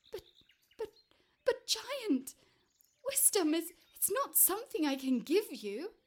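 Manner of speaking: stammering, high-pitched fearful protest; small frightened man pleading with a giant; trembling little man. Acted. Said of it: "man was trembling in his shadow".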